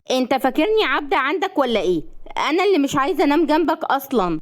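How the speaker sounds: heated quarrelling wife; indignant retort. A narrator's reading. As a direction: hit back, affronted, pitch climbing. Bride snaps back in quarrel.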